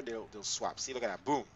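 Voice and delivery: ordinary delivery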